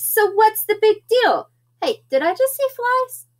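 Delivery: high, lively kitten voice; hammed-up high-pitched little character; cheeky kitten. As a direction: toss it out in a high little kid voice. Kitten chatting to himself.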